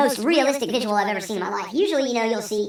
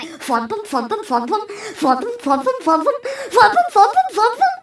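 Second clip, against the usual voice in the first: rhythmic chugging steam noises rising high and loud; cartoon train puffing; 10 semitones higher, 5 dB louder than usual. Storyteller becoming a fifties cartoon train, playful chugging steam sounds. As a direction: chug like a happy little cartoon train. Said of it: "I'm puffing out steam like".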